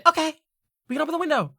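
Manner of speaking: high, loud character voice; grudging tinkerer. Acted. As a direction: squeeze it up high as a put-out tinkerer. Tinkerer grudgingly answers nagging friend.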